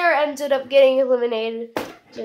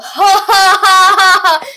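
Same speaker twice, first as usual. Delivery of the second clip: high, loud drawn-out cackle; loud laugh; 7 semitones higher, 12 dB louder, slower than usual. Acted. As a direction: laugh hard at your own joke. Laughs at own joke.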